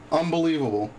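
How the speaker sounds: normal talking voice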